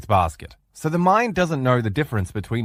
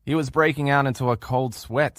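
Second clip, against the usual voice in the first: ordinary delivery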